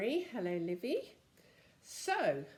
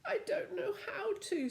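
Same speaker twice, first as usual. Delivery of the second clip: put-on helpless boy; 13 semitones higher than usual. Joe protests.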